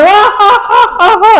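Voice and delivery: high mock laughter; jeering pupils. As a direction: imitate schoolkids laughing at their teacher. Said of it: "Wah, anak-anak pada".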